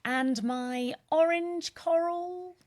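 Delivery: high, slow showy announce; playful intro flourish. Acted. Host introducing her co-host with a high, slow flourish. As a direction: roll it out like a game show host.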